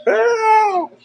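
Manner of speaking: high hollered help, laughing through it; imitated hollering. Telling friends how Derek hollered outside, high laughing yell. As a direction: cry help way up high while cracking up. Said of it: "He's outside hollering".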